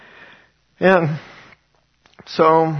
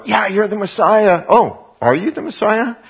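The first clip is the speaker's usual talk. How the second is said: high, eager crowd voices; excited crowd; 8 semitones higher, 3 dB louder than usual. Retold or imitated. Temple crowd hailing Jesus, excited and higher. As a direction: cheer him on, pitch lifted.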